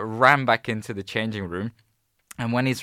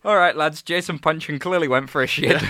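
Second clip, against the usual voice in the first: mock fan leader; 6 semitones higher, 4 dB louder than usual. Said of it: "It's like".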